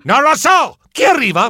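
high frantic "I don't know!"; flustered shout. Acted. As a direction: yell it, shaken and flustered. Flustered shout while being shaken.

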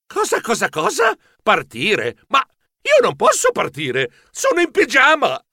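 mildly stammering, pitch jumping high; flustered stammer. A narrator's reading. Mouse in pyjamas told to leave at once, flustered stammer. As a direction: stumble over the words in a fluster. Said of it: "Io balbettai".

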